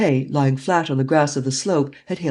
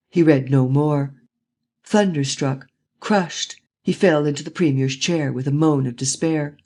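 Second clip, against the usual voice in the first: ordinary delivery, nothing marked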